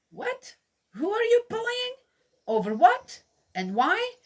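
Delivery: high, incredulous squeak of outrage; a startled, protective cry of disbelief; shocked indignation. Real, not acted. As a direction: jump way up in disbelief at the bully. Indignant at imagined bullies.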